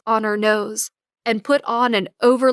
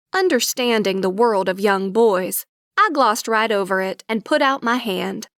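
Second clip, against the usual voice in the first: usual speaking voice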